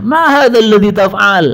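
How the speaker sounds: high, loud, slow furious question; indignant demand. Retold or imitated. Imam Malik confronting the muezzin, high and indignant. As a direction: demand an answer, pitched high and drawn out. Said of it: "Imam Malik kalau sekarang melihat selawatan".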